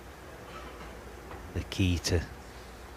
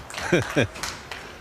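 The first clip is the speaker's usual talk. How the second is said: delighted laugh; 7 semitones higher than usual. Real, not acted. Laughing at shot.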